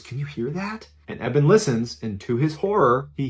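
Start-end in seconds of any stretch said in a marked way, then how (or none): 0.0-0.9 very soft, secretive question; hushed question. Acted. Dad to son while listening for laughter outside, hushed question. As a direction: keep it down, as if something outside might hear.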